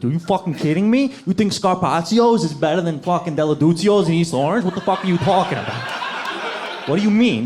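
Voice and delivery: dramatic New Jersey Italian bro outrage; mock Jersey bro. Italian bro from New Jersey arguing about restaurants, Jersey accent. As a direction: argue like a Jersey Shore guy. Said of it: "They put their whole body into it".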